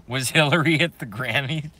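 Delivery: incredulous question through laughter; laughing disbelief. Real, not acted. Streamer hears surprise news from chat, laughing in disbelief. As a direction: crack up while asking it. Hearing news that delights him.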